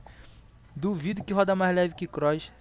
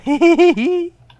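much higher, loud nervous laugh; high panicked laugh; 13 semitones higher, 14 dB louder than usual. Real, not acted. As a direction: burst out in a shrill nervous laugh.

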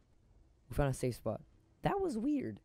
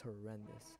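ordinary talk

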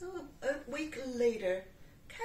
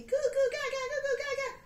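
much higher, loud monkey chatter; 9 dB louder, much faster than usual. Acted. Monkey chattering as it swings in. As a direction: gibber like a giddy monkey.

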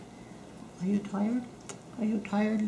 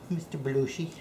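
usual speaking voice

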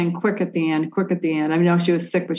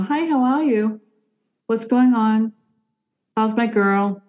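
lightly voiced motherly greeting, pitched higher; 5 semitones higher, slower than usual. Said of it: "a motherly voice going".

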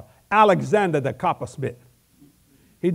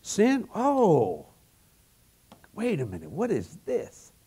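puzzled wonder; 3 semitones higher, slower than usual. Retold or imitated. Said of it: "He went".

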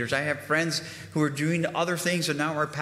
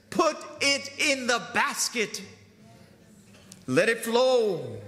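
high, fervent pulpit voice, pressing every promise; impassioned preaching; 10 semitones higher than usual. Acted. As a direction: preach it like you're lifting the room. Preacher exhorting the congregation.

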